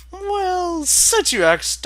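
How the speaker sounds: high, slow, smug pony voice; Twilight voice. Acted. Twilight answering the mech's question, high and slow. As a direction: savor the chance to lecture.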